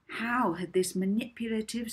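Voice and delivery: plain reading voice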